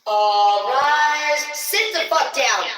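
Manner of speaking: loud, high proclamation; hammed-up commanding shout. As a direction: bellow orders to the whole room. Mock ruler issuing commands.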